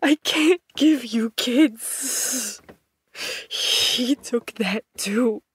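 put-on telling his partner he can't give him kids, slow and pained; pained confession. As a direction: drag out each word as it hurts.

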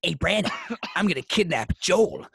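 mock kidnapper's announcement in a put-on voice. Prankster on set telling Brandon his plan, put-on voice. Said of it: "He just puts on this voice, goes".

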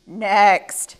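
loud call down the line. Acted. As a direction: call the next one up, loudly. Sheep calls the next animals.

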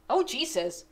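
usual speaking voice

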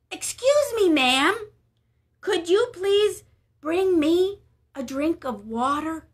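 politely asking a stranger for water, high voice; polite Elijah. Acted. Elijah politely asks the woman.

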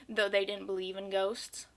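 usual speaking voice

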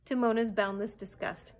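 ordinary delivery

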